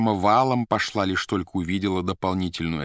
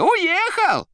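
loud, very high squeal; shouting down phone; 7 dB louder than usual. A narrator's reading. The receiver reporting his departure, shouting shrilly. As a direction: yell it in a squeaky voice. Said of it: "кричала трубка".

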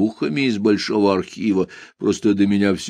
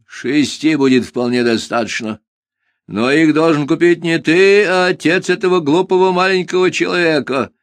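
proud, louder bird declaring; haughty bird voice; 7 semitones higher, 4 dB louder than usual. Acted. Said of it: "заявил Куруш".

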